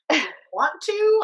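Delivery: high, loud mocking question; sarcastic retort. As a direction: throw it back at the teacher, high and loud. Classmate's sarcastic reply to teacher.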